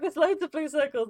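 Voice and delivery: high, loud mock protest; imitated exasperated reply. Voices the confused friend's reply.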